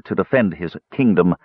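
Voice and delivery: ordinary delivery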